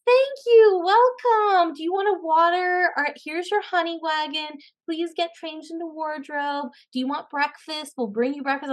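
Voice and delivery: high, bright-eyed fussing, imitating the set staff; eager crew voice. Set crew fussing over a new actress, high and eager. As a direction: play the overly helpful crew fussing over her. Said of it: "They're like".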